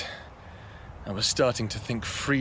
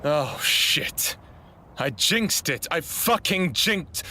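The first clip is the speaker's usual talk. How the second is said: she realises she's in danger; panicked; 5 semitones higher, slower than usual. Acted. Hiker spotting a threat, panicked swearing.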